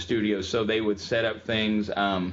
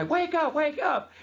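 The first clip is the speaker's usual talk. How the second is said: retold yelling; slower than usual. Starbucks staff yelling at the collapsed caller, high mock shout. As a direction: shout at the fallen man like a panicked crowd. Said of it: "they start yelling at this guy".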